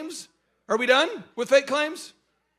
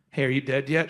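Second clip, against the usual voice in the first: low, deadpan tease; teasing check-in; 8 semitones lower than usual. Retold or imitated. Speaker needles the worried coworker at the next desk, teasing. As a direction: poke at them in a low voice.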